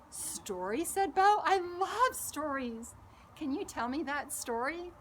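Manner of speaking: eager little Bo. Acted. Bo's eager questions to Gracie.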